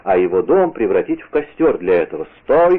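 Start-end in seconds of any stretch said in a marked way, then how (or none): 2.4-2.8 louder, higher halt call; shouted command. A narrator's reading. D'Artagnan from the windowsill to the mob, shouted command. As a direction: order him to halt in a raised voice. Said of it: "крикнул он".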